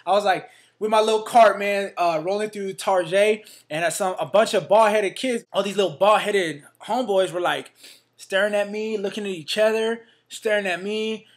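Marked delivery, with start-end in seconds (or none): none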